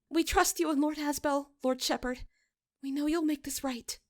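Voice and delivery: a frightened parishioner pressing for the truth; nervous young woman. A narrator's reading. Midge thanks the shepherd.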